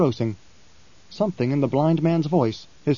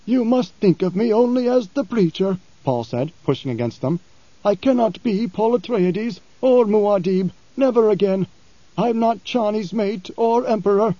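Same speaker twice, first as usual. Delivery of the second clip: preacher; 7 semitones higher than usual. Acted. Paul pushing through the Fremen, firm. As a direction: push back the crowd with your words. Preacher pushes against crowd.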